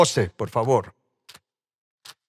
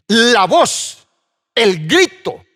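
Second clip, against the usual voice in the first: loud, raised, emphatic phrases; shouted proclamation; 7 semitones higher, 6 dB louder, much slower than usual. Acted. Preacher showing what the blood's voice means, crying out. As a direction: cry out each phrase separately. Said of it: "significa gritar".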